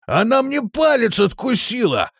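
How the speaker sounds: high, loud wail of pain; wailing complaint; tearful yell. A narrator's reading. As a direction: yell it as if crying. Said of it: "заорал плачущий Крепыш".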